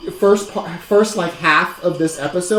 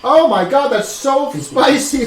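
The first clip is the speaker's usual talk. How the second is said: ordinary talk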